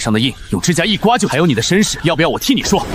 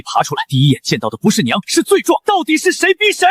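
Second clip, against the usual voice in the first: angry outburst; 11 semitones higher than usual. Acted. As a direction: explode with the last question. Outburst at mother.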